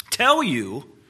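cold witch, starting to rise. A narrator's reading. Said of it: "her voice gr-, growing suddenly shriller".

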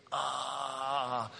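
long, held "ah", kept soft; drawn-out mock groan of pain; acted pained groan. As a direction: groan as if the tailbone just hit ice. Acting out pain after tailbone hit.

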